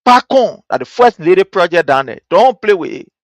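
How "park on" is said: Park on said as "pak on", stressed on park; Park on in Liberian English, "pak on". Shown as a demonstration. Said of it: "in our Liberian English".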